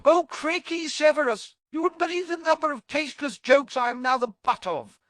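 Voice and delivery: high, loud exasperated outburst.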